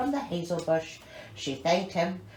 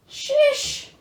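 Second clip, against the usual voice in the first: high exclamation; 6 dB louder than usual. Real, not acted. Exclamation after laughing.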